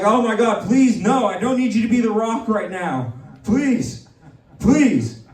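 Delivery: high, pleading whine; comic begging to a bully; mock pleading. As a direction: beg him to stop, hammed up. Acted pleading quote.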